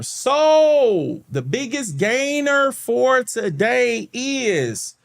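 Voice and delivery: high, drawn-out drumroll announcement; slow, high-pitched game-show buildup; announcer-style reveal. Real, not acted. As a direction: stretch every word to build to the reveal. Dramatic reveal of biggest gainer.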